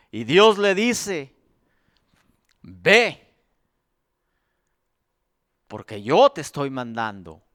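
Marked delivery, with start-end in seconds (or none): 2.6-7.4 low, grave divine command; voice of God. Acted. Dios envía a Moisés. (voz de Dios, grave y baja)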